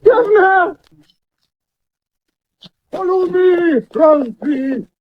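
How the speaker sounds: high, urgent call into the fog. Acted. Roundtree calling for his lost governor, high urgent shout.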